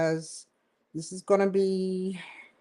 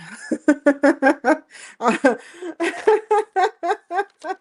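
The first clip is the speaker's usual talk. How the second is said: 1 loud real laugh; 9 semitones higher, 6 dB louder than usual. Real, not acted. Laughs after the Trump quote.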